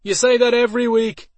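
loud, high call from the stands; shouted heckle. A narrator's reading. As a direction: yell it across the arena. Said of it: "A voice from somewhere in the stands called out".

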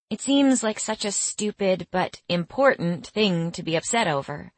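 plain reading voice

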